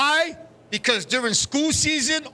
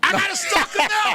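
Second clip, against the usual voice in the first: joking shout; 8 semitones higher than usual. Real, not acted. Repeats joke louder.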